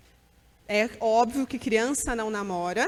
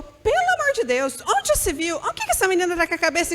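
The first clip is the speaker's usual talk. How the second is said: a mother scandalized by the news; shocked outburst; 6 semitones higher than usual. Retold or imitated. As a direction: pitch jumps way up in alarm. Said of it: "A mãe assustou e falou".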